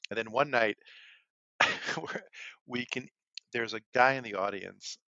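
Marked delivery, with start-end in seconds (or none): none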